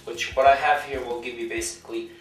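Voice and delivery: usual speaking voice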